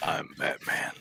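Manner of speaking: low, hushed Batman voice. Acted. Guest imagines turning up at a door as Batman, hushed low voice. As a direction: drop low and quiet, nearly whispered. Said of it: "show up to his door and be like".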